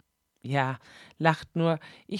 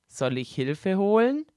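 besorgter Regisseur. Acted. Regisseur besorgt vor der Tür.

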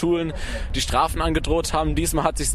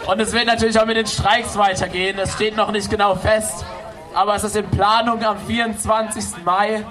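raised, projected address to a crowd; rally speech; 5 semitones higher than usual. Real, not acted. Organizer announces next strikes to the demo crowd, projected speech voice. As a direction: announce plans to the whole square.

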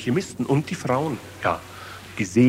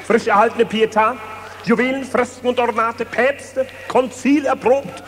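auctioneer; 10 semitones higher than usual. Acted. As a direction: sell it off like a market crier. Auctioning off the Vatican.

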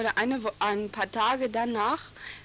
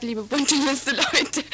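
mock-polite jab, louder and laughing; teasing taunt; 3 semitones higher, 5 dB louder, slower than usual. Real, not acted. Student taunts rival party on radio, louder laughing jab. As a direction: call out the rivals with a grin.

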